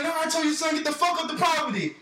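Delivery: high-pitched mock argument; retold angry retort. Man ordering the boy off his property, mock angry. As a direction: argue back hotly, imitating the man. Man arguing back at the dad.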